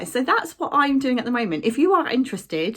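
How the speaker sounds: ordinary delivery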